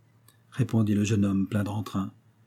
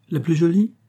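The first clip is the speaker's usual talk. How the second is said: incredulous rising question, pitched higher; surprised echo; 7 semitones higher, 4 dB louder than usual. Acted. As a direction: throw "La plus jolie?" back in disbelief, voice climbing. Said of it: "répliqua le jeune homme". ("La plus jolie?" répété, étonné, la voix montant plus haut)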